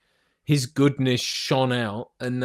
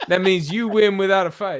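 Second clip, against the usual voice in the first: laughing imitation; 7 semitones higher, 6 dB louder than usual. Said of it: "he goes".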